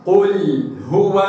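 "قل هو" slow, a heavy qaf, the lam clear; correct qul. Shown as a demonstration. Teacher reciting "قل هو" with a heavy qaf. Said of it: "وإنما".

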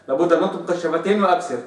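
ordinary delivery

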